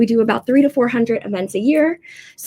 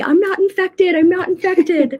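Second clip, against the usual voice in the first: high-pitched, hammed-up defensive plea; mock protest; 9 semitones higher, 4 dB louder than usual. Host with allergies reassuring everyone around her, mock-panicked protest. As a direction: jokingly protest to a nervous crowd. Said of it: "I feel like I just need to be".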